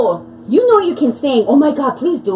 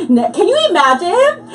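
normal talking voice

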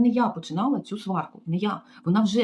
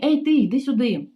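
louder, higher rude summons; mock yell; 5 semitones higher, 5 dB louder than usual. Receptionist shouting at the maid across the lobby, loud rude call. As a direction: call her over like a servant. Receptionist calls her over.

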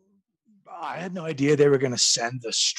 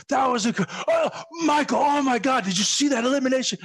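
higher, eager fast rave; gushing fan; 11 semitones higher than usual. Retold or imitated. Impersonating Pat Patterson gushing at Michael Hayes, excited. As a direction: imitate Pat cornering Michael with delight. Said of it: "Pat was like".

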